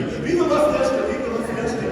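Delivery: high cheerful festive call; sing-song holiday hail; festive greeting. Retold or imitated. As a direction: greet strangers with party cheer. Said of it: "I started to wish everybody".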